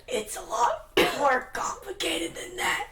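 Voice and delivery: high, slow, squeezed-out voice; crushed and wheezing. Acted. Cain caught in Merlin's crushing hug, acted strained high voice. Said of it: "give out a wheeze as you see him get crushed".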